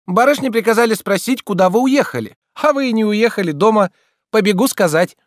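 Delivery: put-on very high girlish voice; excited messenger maid. A narrator's reading. Maid brings the young lady's question, high and excited. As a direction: deliver the errand as an eager high-voiced girl.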